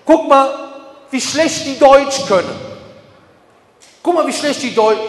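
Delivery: mock agitator. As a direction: imitate someone stirring up outrage. Voicing anti-Islam forum agitators.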